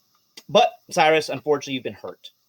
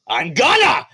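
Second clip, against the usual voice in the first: shark snapping back; 6 semitones higher, 6 dB louder than usual. Acted. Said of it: "And he's like".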